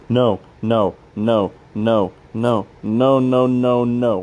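rising, pleading no's, voice thinning; begging against the waves, growing panic; panicked no. Acted. Scientist alone in the station, panicked repeated no.

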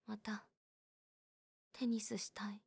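soft, breathy whisper of a shy wish. Acted. Girl whispering to her friend that she wants tennis again, hushed. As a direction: barely voice it, almost to yourself.

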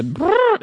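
vocal warm-up lip trill. Shown as a demonstration. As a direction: buzz the lips like a warm-up. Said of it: "is it that thing?".

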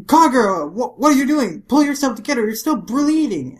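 high, loud urgent appeal; alarmed scolding. Acted. Umibozu going after injured Kagura, alarmed scolding. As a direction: act out worried exasperation.

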